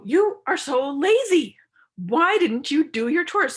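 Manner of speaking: loud, slow, high accusing attack. Shown as a demonstration. Demo of criticism to a lazy partner, loud accusing. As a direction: hurl it as a character attack. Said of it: "criticism would be where you go".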